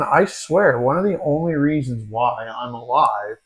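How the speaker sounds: usual speaking voice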